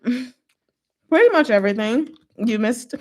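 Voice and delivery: ordinary talk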